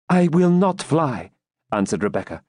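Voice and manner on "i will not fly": high, slow, impassioned heroine; emphatic raised-pitch determination; resolute refusal. A narrator's reading. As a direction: refuse to abandon him. Rebecca refuses to abandon Ivanhoe.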